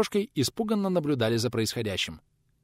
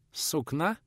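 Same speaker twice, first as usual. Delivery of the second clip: put-on higher, softer questioning boy; pensive echo; 5 semitones higher, 4 dB quieter than usual. A narrator's reading. Boy considers the man's cloth order, pensive question. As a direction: repeat it thoughtfully with a rise. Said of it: "задумчиво переспросил паренёк".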